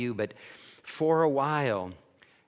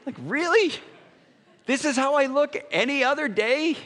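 much higher and louder, offended; indignant disbelief; 12 semitones higher, 5 dB louder than usual. Retold or imitated. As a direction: fire back in mock outrage. Indignant retort, high loud.

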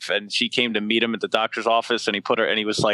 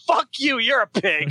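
mock angry woman; 8 semitones higher than usual. Retelling the woman's angry reply.

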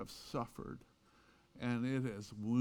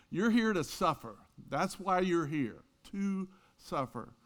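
emphatic declaration; 6 semitones higher, 4 dB louder than usual. Acted. Preacher's blunt punchline.

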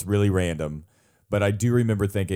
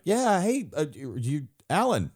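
awkward greeting; 8 semitones higher than usual. Retold or imitated. Acting out awkward airport greeting.

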